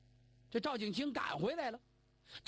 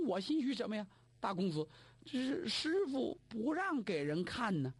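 plain reading voice

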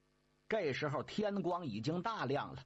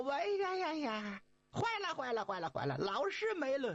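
alarmed cry; 9 semitones higher than usual. Acted. Monkey finding master vanished, high alarmed cry. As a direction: panic that the master is gone.

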